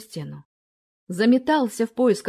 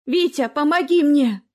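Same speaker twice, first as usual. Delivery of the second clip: higher, louder plea for help; pleading cry; 6 semitones higher, 5 dB louder than usual. A narrator's reading. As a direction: beg for help in fear. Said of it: "голос Дины".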